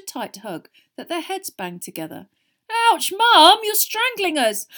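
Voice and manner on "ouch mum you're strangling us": high, loud yelp; pained protest. A narrator's reading. Twins to their hugging mother, loud pained protest. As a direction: squawk as if squeezed too tight.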